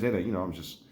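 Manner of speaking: ordinary talk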